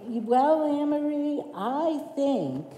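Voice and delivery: high, laughing take on the pastor's earnest ask; imitating Dave. Telling the church how her pastor asked her on staff, high mock voice. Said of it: "in Dave's way, you know how Dave can be".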